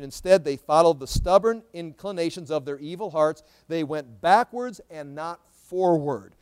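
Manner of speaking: loud, emphatic Bible proclamation; forceful scripture reading. Acted. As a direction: preach the verse out with force. Reads scripture aloud to congregation.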